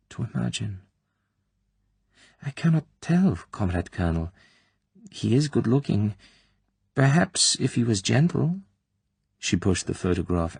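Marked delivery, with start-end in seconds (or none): 2.4-8.6 a lightly voiced, higher, hesitant girl; timid young woman. Tatiana answering the colonel cautiously, timidly. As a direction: answer carefully, unsure what she wants.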